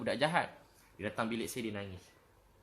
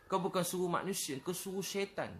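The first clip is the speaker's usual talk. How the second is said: higher-pitched mock reprimand; imitated scolding; 6 semitones higher than usual. Someone scolds a convert for wrong azan, imitated scolding. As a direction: mimic an indignant telling-off.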